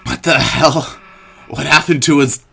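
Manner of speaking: loud startled outburst; shocked Susie. Acted. Susie reacts to the old man changing, loud and shocked. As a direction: blurt it out in shock.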